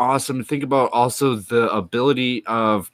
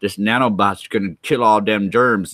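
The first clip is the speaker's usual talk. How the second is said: usual speaking voice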